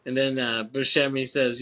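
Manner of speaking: ordinary talk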